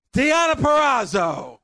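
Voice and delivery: big winner reveal, called out; excited announcement. Real, not acted. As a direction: shout the winner's name out loud.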